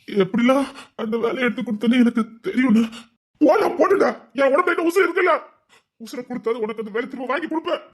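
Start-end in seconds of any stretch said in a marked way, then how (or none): 3.4-5.3 impassioned vow. Acted. Brother's emotional vow.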